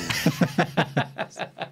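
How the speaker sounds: unvoiced run of chuckles; breathy laugh. Real, not acted. Host amused by Johnny's comeback, breathy laugh. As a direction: laugh helplessly under the breath.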